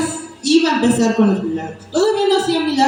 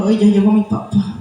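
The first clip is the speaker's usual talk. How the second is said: put-on low, flat, let-down child greeting; glum greeting; 4 semitones lower than usual. Child resentful of tired dad, glum greeting. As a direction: say it with no joy, dad is home again. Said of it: "va a decir".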